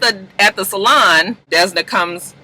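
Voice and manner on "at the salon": ordinary talk, nothing marked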